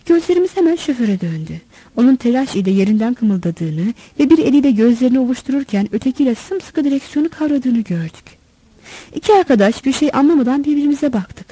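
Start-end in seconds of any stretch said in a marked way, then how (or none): none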